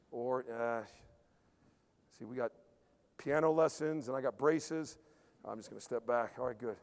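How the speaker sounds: low, soft backing-down murmur; quiet inner voice. Retold or imitated. Player deciding to step back, low murmur. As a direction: talk yourself out of it, barely audible. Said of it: "now you got a little situation".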